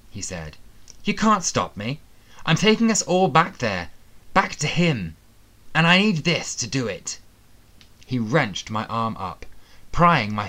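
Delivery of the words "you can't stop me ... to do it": angry. Acted. Attacker threatens, pinning hero.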